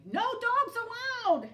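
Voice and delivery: very high, loud and slow grumpy yell. A narrator's reading. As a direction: shout the sign at the coyote. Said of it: "Hank yelled".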